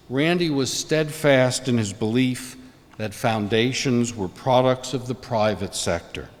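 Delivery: ordinary talk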